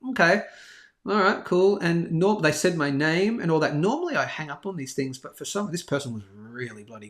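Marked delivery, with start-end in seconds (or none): 0.1-1.8 higher-pitched, wary going along with it; guarded, raised-pitch acknowledgement; suspicious. Retold or imitated. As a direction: play along, not yet convinced. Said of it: "I was suspicious in the beginning".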